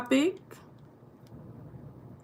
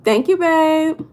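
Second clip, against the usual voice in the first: drawn-out thanks; 8 semitones higher, 6 dB louder, much slower than usual. Real, not acted. Fond thanks to chat.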